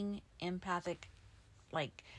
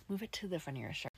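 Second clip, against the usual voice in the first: ordinary talk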